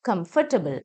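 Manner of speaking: കംഫർട്ടബിൾ as "KUM-fuh-tuh-bl"; neutral vowel. Shown as a demonstration. Teacher models the word for learners, neutral middle vowel. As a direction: keep a short "fuh" in കംഫർട്ടബിൾ. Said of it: "ഏ sound ആ വരുന്നത്".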